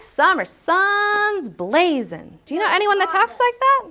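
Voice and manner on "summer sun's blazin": drawn-out "sun's" and "blazing" ending in n; boy's dropped g. Acted. Teacher imitating how the boy talks, dropped-g "blazing". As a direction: stretch "sun's", drop the g of "blazing". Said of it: "Maybe he talks like".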